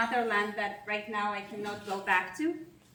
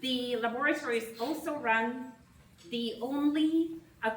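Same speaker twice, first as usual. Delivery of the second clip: usual speaking voice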